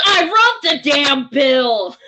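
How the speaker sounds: slow mock-furious shout; retold shout. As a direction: bellow it like the bill's author. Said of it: "And he goes".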